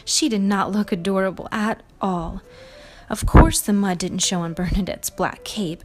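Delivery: plain reading voice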